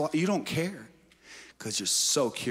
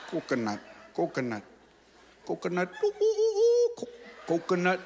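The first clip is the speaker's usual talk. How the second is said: monkey voice; 6 semitones higher, faster than usual. Pretend. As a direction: ham up a giggling monkey going for the coconut. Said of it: "I said it in a monkey accent".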